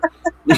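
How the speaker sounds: a short amused cackle; sheepish laugh at himself; high giggle. Real, not acted. Laughs at own admission.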